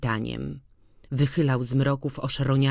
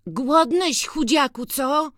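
tender coaxing; 11 semitones higher, slower than usual. A narrator's reading. Boy coaxing returned dog.

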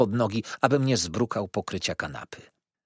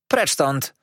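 sharp, louder command to clear off; raised voice, driving someone away; shouting; 4 semitones higher, 6 dB louder than usual. A narrator's reading. Aunt to a street urchin in her garden, shouting. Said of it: "zawołała".

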